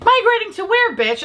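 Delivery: high, sharp exasperated jab; incredulous retort. Real, not acted. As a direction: fire back in disbelief. Mocking the app's message.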